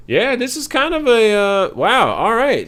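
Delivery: surprised. Real, not acted. Reacting to a chart.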